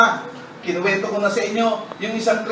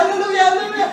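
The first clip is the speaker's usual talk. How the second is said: much higher, hammed-up hallelujah; high mock hallelujahs; 11 semitones higher, much faster than usual. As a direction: squeal it out in mock ecstasy. Still falling, shouting praise.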